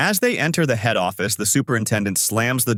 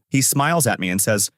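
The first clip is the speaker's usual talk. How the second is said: ordinary delivery, nothing marked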